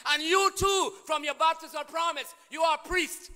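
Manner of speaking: loud, high preaching that builds to a shout; rising pulpit shout; full-force preaching. Acted.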